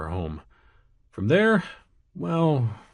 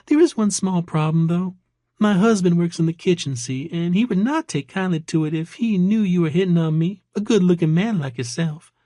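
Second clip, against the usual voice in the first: high-pitched Georgia waitress; Georgia accent; 8 semitones higher than usual. A narrator's reading. Waitress warning her admirer about her husband, Georgia accent. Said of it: "an accent that was distinctly from Georgia".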